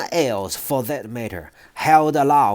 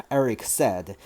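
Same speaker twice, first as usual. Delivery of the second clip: ordinary delivery, nothing marked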